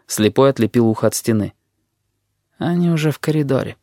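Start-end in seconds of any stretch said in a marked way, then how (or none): 2.6-3.8 understated, offhand report; mildly detached remark; indifferent. A narrator's reading. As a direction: say it as if it hardly matters. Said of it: "сказал он безразличным тоном".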